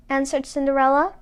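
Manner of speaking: usual speaking voice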